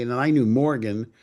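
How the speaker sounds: normal talking voice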